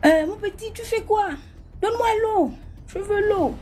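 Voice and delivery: a stranger outside in the dark, in a high thin voice; thin accented voice. Retold or imitated. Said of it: "Avec l'accent fin".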